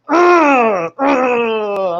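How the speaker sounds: loud pained grunts; real pain groans. Real, not acted. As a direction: groan out from the jolt. Reacting to the shock.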